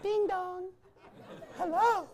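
high, soft mock car sound; mock smart car chime. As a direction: squeak it like a dainty car greeting. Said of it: "what it sounds like when you turn it on".

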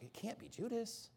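quiet imagined thought; inner thought. Retold or imitated. Disciples doubting to themselves, softly and quietly. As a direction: drop to a near-murmur of disbelief. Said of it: "They're just thinking".

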